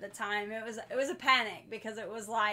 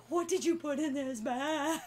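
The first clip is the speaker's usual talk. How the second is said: mock shivering, higher-pitched panicked question; imitated shaking panic; 4 semitones higher than usual. Host imitating the man shaking in the tub, mock panic. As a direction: play someone shaking in distress, pitched up. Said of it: "he's, like, shaking".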